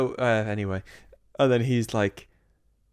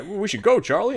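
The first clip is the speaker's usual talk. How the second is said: hurried dad voice; 9 semitones higher, 5 dB louder than usual. Retold or imitated. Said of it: "he's like".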